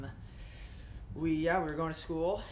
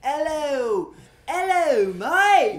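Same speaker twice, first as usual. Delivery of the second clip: louder, higher hammed-up Aussie greeting; loud Australian accent; 6 semitones higher, 12 dB louder, much slower than usual. Bandmate showing off his Australian accent, loud and hammy. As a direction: belt it out like a cartoon Australian. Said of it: "Is that more Australian?".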